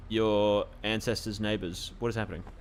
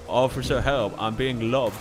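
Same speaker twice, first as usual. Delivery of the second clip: loud mock cry of "I'm being lobbed"; imitating game accent; 2 semitones higher, 7 dB louder, slower than usual. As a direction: call "lobbed" for help with a thick accent. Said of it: "That's what he says".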